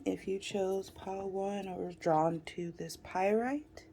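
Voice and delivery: ordinary talk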